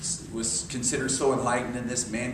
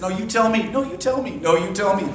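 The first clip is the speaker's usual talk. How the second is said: insistent refusal; 5 semitones higher, 5 dB louder than usual. Retold or imitated. Repeated insistence to girlfriend.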